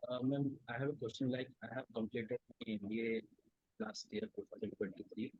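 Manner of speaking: very faint, far-off voice; softly, barely reaching the mic; barely audible. Real, not acted.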